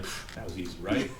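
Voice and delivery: smug little scoff. Retold or imitated. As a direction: brush it off like it was nothing. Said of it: "And I was like".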